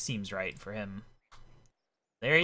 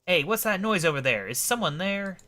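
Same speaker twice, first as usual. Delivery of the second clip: eccentric gent, mildly alarmed; anxious old-fashioned gentleman; 6 semitones higher, 7 dB louder than usual. Acted. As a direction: play a storybook gentleman wary of being overheard. Voices Rupert in game dialogue.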